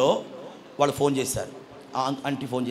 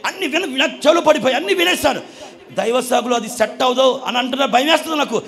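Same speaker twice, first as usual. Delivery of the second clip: put-on high, loud, upset girl pleading with her mother; daughter's loud protest; 9 semitones higher, 8 dB louder than usual. Daughter to her mother, refusing the marriage: high loud upset plea. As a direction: cry out like a frightened daughter refusing the match.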